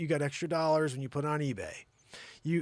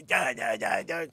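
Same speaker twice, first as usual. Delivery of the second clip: loud repeated cry; mock frantic begging; 3 semitones lower, 4 dB louder than usual. [name] holds on to milkman, mock frantic shouting. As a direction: blurt it in a loud panic.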